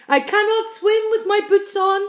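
high, loud centipede voice; loud, urgent protest; panicked shout. A narrator's reading. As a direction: yell it in alarm. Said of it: "shouted the centipede".

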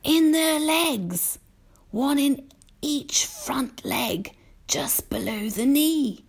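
high, very slow, airy reveal; old grasshopper. A narrator's reading. Old grasshopper describes his cousins' ears, slow and high. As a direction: savour each detail slowly.

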